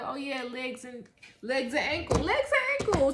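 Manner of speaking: high, loud mocking singsong; mocking careless voice. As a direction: mimic someone who forgets their feet. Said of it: "We be like".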